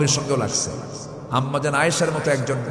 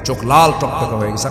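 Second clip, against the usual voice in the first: ordinary delivery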